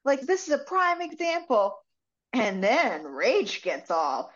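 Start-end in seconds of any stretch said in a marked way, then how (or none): 0.0-1.8 high-pitched mock yell; retold yelling. Senator's assistant ordering the camera crew, mock yelling. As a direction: call it out high, imitating an indignant assistant. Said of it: "the senator's assistant starts yelling".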